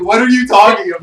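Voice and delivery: loud baffled shout. Retold or imitated. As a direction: bellow it in total confusion. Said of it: "and I was like".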